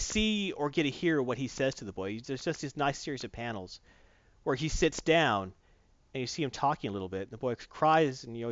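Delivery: usual speaking voice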